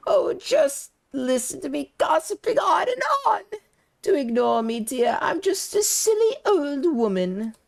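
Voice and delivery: a nosy, kindly neighbour cooing over gossip; sweet gossiping old lady. Acted. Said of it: "such an authentic voice for that character".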